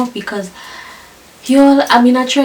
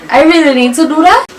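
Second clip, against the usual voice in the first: higher, louder, mock-incredulous ask; disbelieving question; 7 semitones higher, 8 dB louder than usual. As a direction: balk at it, voice jumping up high. She balks at the enema.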